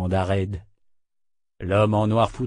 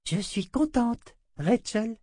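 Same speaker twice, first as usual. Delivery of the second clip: a doll gently answering; 12 semitones higher, slower than usual. A narrator's reading. La poupée parle. (voix de poupée douce et lente)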